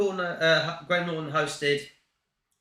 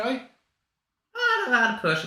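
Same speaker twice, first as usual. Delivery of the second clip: put-on Welsh accent; 7 semitones higher, much slower than usual. Poor attempt at a Welsh accent.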